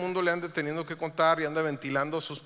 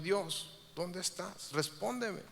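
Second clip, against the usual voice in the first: hushed plea; 10 dB quieter, slower than usual. Retold or imitated. Psalmist crying out to God.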